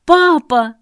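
a loud, high cry for dad; shout. A narrator's reading. Daughter screams for her father at the door. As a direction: scream for papa in panic. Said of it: "закричала я".

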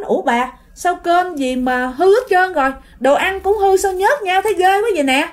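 acted alarm over spoiled food; dismayed uncle. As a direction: exclaim in disgusted disbelief. Uncle finds the meal spoiled.

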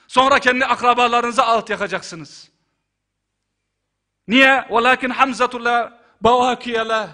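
loud, high-pitched sermon at full force; impassioned preaching. Acted. Abdullah calls his family to mourn the Prophet's uncle, impassioned preaching.

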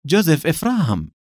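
lifted, put-on surprise; feigned astonishment. A narrator's reading. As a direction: repeat the name as if amazed. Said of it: "متظاهرا بالدهشة وهو يردد".

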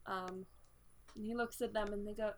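ordinary talk, nothing marked

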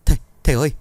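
high, loud panicked call to the master; loud frightened cry for help. A narrator's reading. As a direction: shout for the master in alarm. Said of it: "gọi lớn".

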